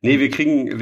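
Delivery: normal talking voice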